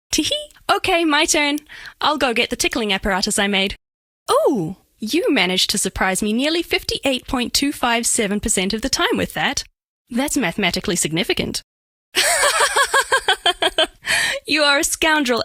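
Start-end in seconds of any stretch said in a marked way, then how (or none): 0.1-0.5 a high, cute little tee-hee giggle; playful giggle. Acted. Giggling after tickling talk. 12.1-14.4 high, rising burst of laughter; delighted laugh. Acted. Laughs at being surprised.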